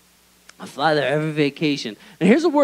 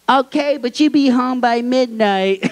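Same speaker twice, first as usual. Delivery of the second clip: higher, fussy mom voice; imitating mom; 6 semitones higher, 3 dB louder than usual. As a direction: play the nagging mother. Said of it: "And she goes".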